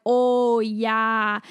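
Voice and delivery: drawn-out Canadian "Oh, yeah"; Canadian accent. Retold or imitated. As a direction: lean into the Canadian vowels. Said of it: "your accent's so funny".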